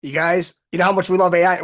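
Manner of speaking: normal talking voice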